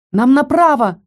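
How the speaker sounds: high, urgent call; shouting. A narrator's reading. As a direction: call out the way in a hurry. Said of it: "закричала я".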